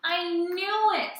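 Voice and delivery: high, loud, slow gleeful crow; delighted triumph; whoop of delight. Acted. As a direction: savour the victory. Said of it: "with a whoop of delight".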